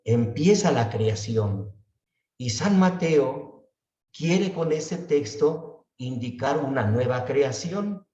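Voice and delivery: usual speaking voice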